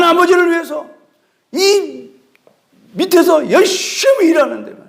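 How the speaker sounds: high-pitched, acted indignation; defiant protest. Older son defying his father, acted indignant protest. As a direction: stand up to him, voice pitched high and insistent. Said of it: "씩씩대면서 아버지한테 대드는 거예요".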